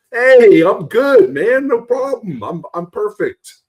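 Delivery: mock a wrestler brushing off his injury; sing-song mocking reassurance; mocking fake cheer. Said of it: "we're gonna come back".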